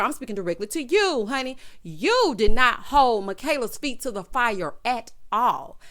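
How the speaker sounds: ordinary talk, nothing marked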